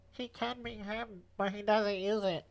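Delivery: very high-pitched mocking whine; mock whiny. Mocking their own recorded clip, exaggerated high whine. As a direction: exaggerate your own whining, drawn out and high. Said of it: "why am I whining so much".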